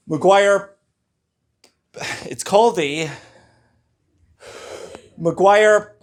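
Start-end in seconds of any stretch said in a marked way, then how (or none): none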